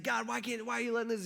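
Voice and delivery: high-pitched, questioning cry to God; acted distressed plea. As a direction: plead upward, acting out bewilderment. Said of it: "like an emotional roller coaster".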